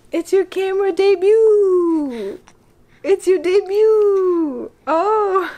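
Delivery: high, slow, loud cooing. Real, not acted. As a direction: sing it out to the newborn. Mother cooing at her baby.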